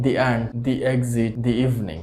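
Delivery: "the end, the exit" slowly with "the" as "di"; slow, long ee in each "the". Shown as a demonstration. Teacher recaps di before vowels slowly. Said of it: "টিএইচই এর উচ্চারণ হবে দি".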